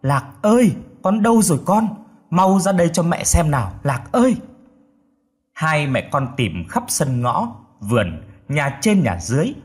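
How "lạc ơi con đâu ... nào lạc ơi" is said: put-on high, pleading call to a lost child; tearful calling. A narrator's reading. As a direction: narrator acting a distraught mother. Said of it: "Thím khóc lóc gọi".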